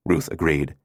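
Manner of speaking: ordinary delivery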